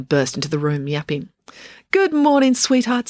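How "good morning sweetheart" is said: high, loud doggy sweet talk. A narrator's reading. Loretta greets the little dog Pumpkin, high cooing. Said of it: "bending to give the little dog a pat".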